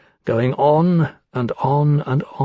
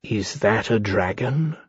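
slow, softer, hesitant question; slowly; 3 semitones lower, 5 dB quieter than usual. A narrator's reading. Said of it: "said slowly".

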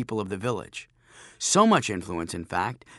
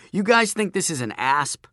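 higher-pitched challenging question; mock disbelief; 6 semitones higher, 4 dB louder than usual. Narrator to bullies facing a snake, raised teasing question. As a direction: lightly taunt them with a question.

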